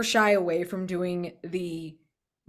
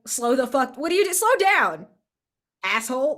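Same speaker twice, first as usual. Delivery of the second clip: mock yell; 5 semitones higher, 5 dB louder than usual. Voicing player yelling at sibling.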